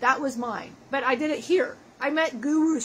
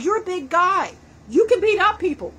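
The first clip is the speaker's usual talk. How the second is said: rising pep talk; 6 semitones higher, 6 dB louder than usual. Retold or imitated. Building retold pep talk.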